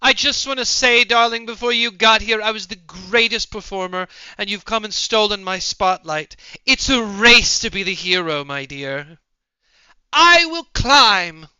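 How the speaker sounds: high, loud declamation; theatrical shouting. Acted. Performer vowing to be the hero, theatrical shout. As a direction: project it like a rival stage star.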